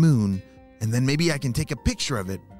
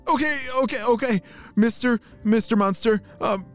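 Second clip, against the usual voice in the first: put-on tag nervous boy; 13 semitones higher than usual. Boy nervously addresses the monster.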